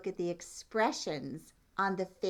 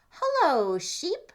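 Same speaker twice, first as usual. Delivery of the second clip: high, loud chirpy duck greeting; cheery duck; 10 semitones higher, 8 dB louder, slower than usual. Acted. Duck greets the cow, high and loud. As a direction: sing out hello like a happy duck.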